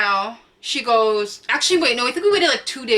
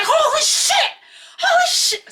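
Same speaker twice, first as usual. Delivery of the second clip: much higher, louder panicked shout; mom shrieking; 3 dB louder than usual. Retold or imitated. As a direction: imitate mom screaming in shock. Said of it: "She's like".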